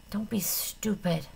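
soft, slow breathy whisper; weak whisper. A narrator's reading. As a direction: barely breathe it out. Dying girl, unvoiced.

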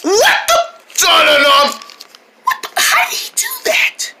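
high startled what the; shocked outcry. Acted. To himself when the shield fails, a high shocked squeal. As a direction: squeal in disbelief.

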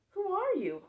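high, loud startled ask; surprised question. Acted. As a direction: blurt it out, startled. Narrator voices surprised question.

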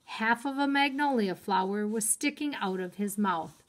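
plain reading voice

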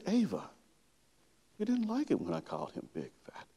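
put-on tender, hushed check-in with a toddler; gentle parent. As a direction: tread carefully, asking softly. Father gently asking his small daughter.

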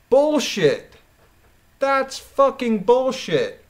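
loud, high, slow angry cursing; furious outburst. Real, not acted. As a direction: spit it out in full outrage. Furious about the evidence timeline.

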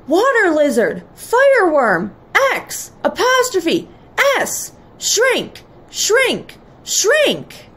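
high, punched magic words; commanding high-pitched incantation; shouted spell. A narrator's reading. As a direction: cast the spell in short separate bursts. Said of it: "he shouted".

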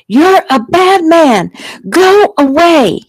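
high, loud, drawn-out scream; child's scream. A narrator's reading. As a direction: scream it slowly at the top of your voice. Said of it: "Jeffy screamed".

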